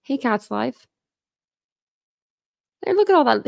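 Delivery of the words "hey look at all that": higher and louder, pleased exclamation; delighted. Real, not acted. Streamer seeing her chat fill up with names, delighted. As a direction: beam at the crowded chat.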